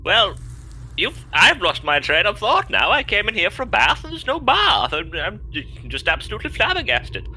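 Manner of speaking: indignant posh gentleman. Acted.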